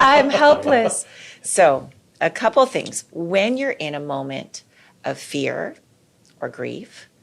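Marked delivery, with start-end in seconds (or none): 0.0-1.0 high, slow, put-on helpless voice; mock helpless. Teasing a friend about feeling powerless, mock helpless whimper. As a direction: play the overwhelmed protector, high and slow.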